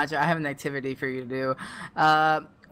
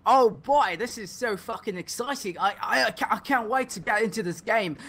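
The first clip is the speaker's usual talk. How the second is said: higher, hammed-up British accent, eager; mock British excitement; 5 semitones higher than usual. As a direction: overplay a British fan's thrill. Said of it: "if we're doing fake accents".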